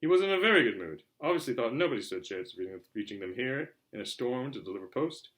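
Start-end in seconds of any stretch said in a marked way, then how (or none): none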